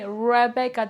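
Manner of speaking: Rebecca with an untrilled English R and hard b; English pronunciation attempt. Shown as a demonstration. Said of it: "It's so difficult for me".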